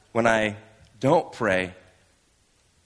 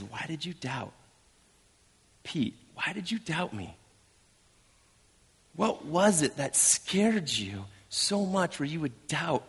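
gentle reproach; 4 semitones higher, 5 dB quieter than usual. Acted. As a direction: ask gently, with pauses, as a saddened rescuer. Jesus gently questions sinking Peter.

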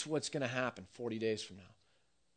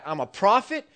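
loud, higher prophet's complaint; indignant Jonah; 7 semitones higher, 8 dB louder than usual. Retold or imitated. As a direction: complain loudly that your doom never came. Preacher in full flight, mimicking Jonah.

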